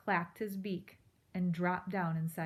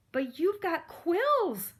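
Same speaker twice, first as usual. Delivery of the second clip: much higher, slow, louder protest; 10 semitones higher, 7 dB louder, slower than usual. A narrator's reading. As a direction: whine it out as an objection. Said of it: "Poppy protested".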